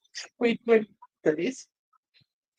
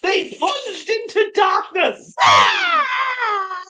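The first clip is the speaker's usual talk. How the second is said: dramatic exclaim; 11 semitones higher, 7 dB louder, much slower than usual. A narrator's reading. Dramatic plunge.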